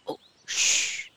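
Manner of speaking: unvoiced hushing whisper; frightened whisper. A narrator's reading. Rabbit hushes laughing Alice, whispering. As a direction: hush her in a panic. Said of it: "压低声音说".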